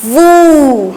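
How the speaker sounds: वू with a long, held "oo"; pronunciation demo. Shown as a demonstration. Teacher showing the pronunciation, वू drawn out. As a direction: stretch the vowel of वू. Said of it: "इसका उच्चारण निकलेगा".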